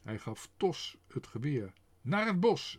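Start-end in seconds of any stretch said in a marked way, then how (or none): 2.0-2.8 high, loud shout. A narrator's reading. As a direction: bark the order. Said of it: "schreeuwde hij".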